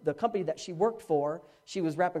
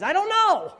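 loud, high burst of bafflement; exasperated shout; 11 semitones higher, 7 dB louder, slower than usual. Acted. Preacher answering why Jesus would do that, exasperated shout. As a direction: throw it up high and holler.

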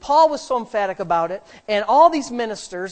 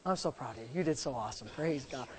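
usual speaking voice

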